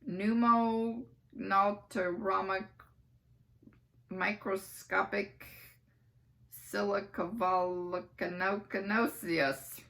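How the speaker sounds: slow, syllable by syllable; slow, careful pronunciation; carefully sounded out. Acted. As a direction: drag out every part of the long word. Careful spelling out of long word.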